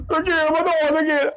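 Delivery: put-on high-pitched childish crying in pain; mock tearful complaint. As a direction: whine it like a hurt child. Acting caned child crying in pain. (rengekan budak kesakitan)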